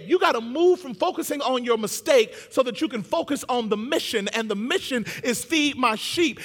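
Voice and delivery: high, loud, driving sermon delivery; full-force preaching. Real, not acted. Preacher urging congregation from mistake to mission, impassioned and loud. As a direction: preach it hard, punching the key words.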